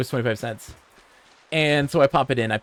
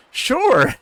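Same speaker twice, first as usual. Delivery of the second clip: mock-innocent sure; 12 semitones higher, 7 dB louder than usual. Mock-innocent reply to bully.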